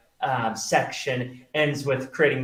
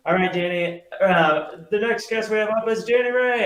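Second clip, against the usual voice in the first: raised, projected emcee introduction; announcer voice climbing higher into the name; stage announcer; 9 semitones higher, 4 dB louder than usual. Retold or imitated. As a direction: imitate a host bringing on the next act. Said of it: "And they're like".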